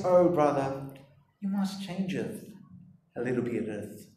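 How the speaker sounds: very soft, low, hushed coaxing; overly tender, dropping almost to nothing; mock gentle correction. Preacher parodying an overly loving way of correcting a brother, mock gentle. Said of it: "always gonna sound like this".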